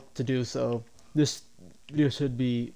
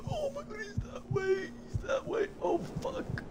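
very high, strained panicked cry; panicking shriek; 6 dB quieter than usual. Real, not acted. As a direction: squeal in terror as he appears. Monster teleports, gamer shrieks.

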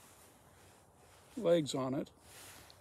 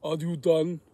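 loud, booming big man's question; big, loud voice of a giant; imitated big voice; 8 dB louder than usual. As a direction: boom it out as a huge man. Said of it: "hear this big voice go".

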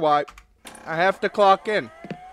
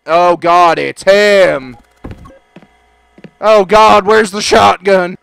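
loud panicked cry; loud mock panic; 11 dB louder, slower than usual. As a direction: freak out at him. Panic as he arrives.

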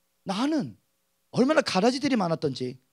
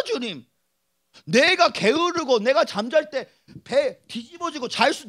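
normal talking voice

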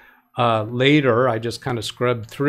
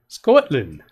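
a hint of a Scottish accent on "Scotland", a bit louder; bad Scottish accent; 5 dB louder than usual. Host reading about Ayr tries a bad Scottish accent. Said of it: "That's a terrible accent".

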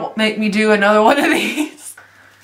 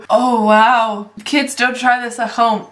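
ordinary delivery

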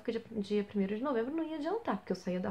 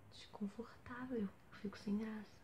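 whisper; 11 dB quieter than usual. Real, not acted. Admitting to viewers in a whisper that she feels awkward. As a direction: murmur it barely audible. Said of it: "eles podem ouvir".

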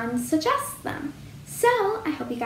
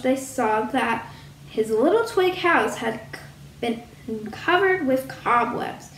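ordinary delivery, nothing marked

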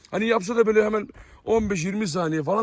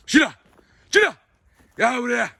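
high, punchy call across a distance; calling a dog; 6 semitones higher, 7 dB louder than usual. Real, not acted. Owner summoning the dog Şila, calling out. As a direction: call the dog over loudly.

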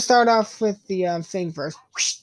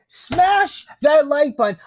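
higher, louder, slower punchy call; loud hype shout; 6 semitones higher, 4 dB louder than usual. Acted. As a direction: pump up the viewers with a shout. Hammy loud call to viewers.

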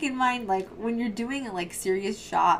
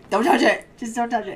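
raised, louder warning; higher, punchy retold command; 4 semitones higher, 9 dB louder than usual. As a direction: blurt out an urgent hands-off warning, retold. Retelling own warning to Josh.

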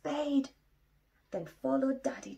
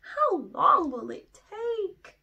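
higher, louder, slow whine; whiny cub; 10 semitones higher, 9 dB louder, slower than usual. Acted. Little Bear whines to Daddy about the long walk, high and slow. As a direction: drag out the complaint.

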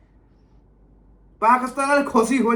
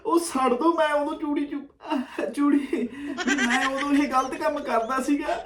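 higher-pitched mock pleading, breaking into laughs; mocking imitation; 3 semitones higher than usual. Reactor parrots the man's video-deletion plea, mocking imitation. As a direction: mimic a complainer to make fun of him. Mockingly re-acts the clip just played.

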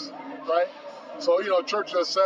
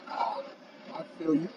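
outwardly understanding; 7 dB quieter than usual. Retold or imitated. Actor answering casting director about the offer, quietly understanding. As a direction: play it cool, keep it quiet. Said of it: "Inside, I'm like".

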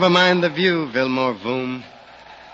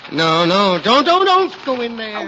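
high, louder panicked don'ts; frightened plea; 9 semitones higher, 5 dB louder than usual. Acted. Rip, scared of Calamity Cave, begs his companion, frightened. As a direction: beg him not to go in.

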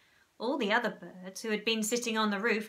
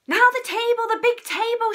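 very loud, high shout; 13 semitones higher, 10 dB louder than usual. A narrator's reading. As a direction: call for the table. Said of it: "shouted Mugglewump".

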